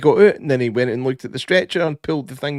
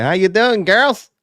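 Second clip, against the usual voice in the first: cheery sheriff greeting; 7 semitones higher, 5 dB louder, slower than usual. Retold or imitated. Sheriff voiced in retold scene.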